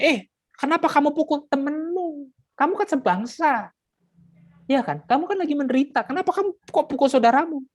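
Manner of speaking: high, rebuking voice of Moses; Moses scolding. Acted. Moses scolding a Hebrew beating his fellow Hebrew, high and stern. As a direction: scold the brother for hitting his kinsman.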